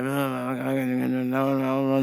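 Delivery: low, run-together gibberish mumble; imitated mumbling. Customer to baffled worker, unintelligible mumbling. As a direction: mumble low so no word can be pieced together. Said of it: "trying to piece together what he was saying".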